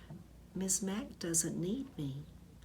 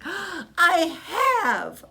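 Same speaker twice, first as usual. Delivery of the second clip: high loud hamster squeak; 12 semitones higher, 12 dB louder than usual. A narrator's reading. Hamster insisting to his teacher, high loud squeak. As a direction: squeak it out eagerly like a tiny hamster. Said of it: "I squeaked".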